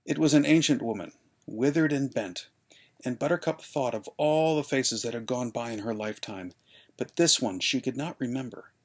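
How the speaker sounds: ordinary delivery, nothing marked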